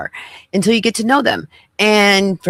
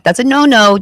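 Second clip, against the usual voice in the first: raised, louder mock scolding of a child; 7 semitones higher, 5 dB louder than usual. Streamer scolding [name] like a naughty toddler, raised and loud. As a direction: wag a finger at a naughty kid.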